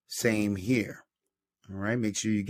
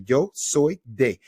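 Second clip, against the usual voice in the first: "Yo soy de" said slowly and clearly; slow model for the class; 3 semitones higher, 3 dB louder, slower than usual. Shown as a demonstration. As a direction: spell it out slowly for repeating.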